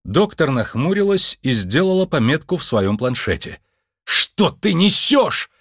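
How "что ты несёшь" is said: high, slow, emphatic snap; angry bark. Acted. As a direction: snap it high, leaning on every word. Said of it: "рявкнул Джо". (сердитый рявк)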